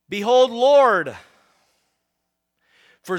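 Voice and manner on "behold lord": loud, slow, reverent call; proclaiming. Acted. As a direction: cry out to the Lord. Zacchaeus calls out to Jesus.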